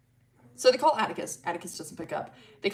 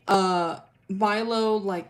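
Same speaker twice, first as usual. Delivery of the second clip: normal talking voice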